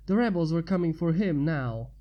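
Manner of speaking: usual speaking voice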